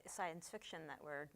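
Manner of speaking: ordinary talk, nothing marked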